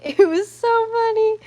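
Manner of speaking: laughing about the pig biting Ellie. Real, not acted. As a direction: laugh it out, tickled.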